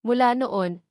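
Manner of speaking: ordinary delivery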